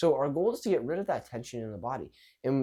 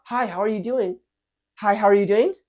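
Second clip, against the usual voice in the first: high, loud, over-eager greeting rising at the end; too fast, high-pitched; 7 semitones higher, 8 dB louder than usual. Shown as a demonstration. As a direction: pitch it up high, ending like a question. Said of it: "Too fast, too high-pitched".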